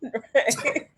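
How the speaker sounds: ordinary talk, nothing marked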